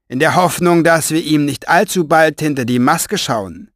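strained, pressed voice, high and loud. Acted. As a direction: squeeze the words out, mocking from above. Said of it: "Seine gepresste Stimme".

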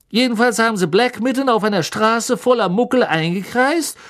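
high, loud breathless storyteller; excited gossip. Acted. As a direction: relish every grisly detail. Stan voiced by narrator.

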